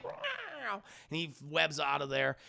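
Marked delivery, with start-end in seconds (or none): 0.0-0.8 high, soft creature yowl; thin high-pitched mock screech; Venom's screech. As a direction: imitate a monster squealing as it is hit. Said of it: "And Venom's like".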